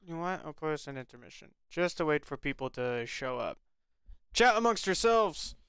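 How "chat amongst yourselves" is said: mock announcer. Mock announcer telling chat.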